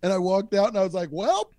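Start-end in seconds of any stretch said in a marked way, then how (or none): 1.1-1.5 high, drawn-out announcement; mock holler. Storyteller walking out after being fired, high mock holler. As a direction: sing out the news, pitched way up. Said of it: "at, like, the top of my lungs".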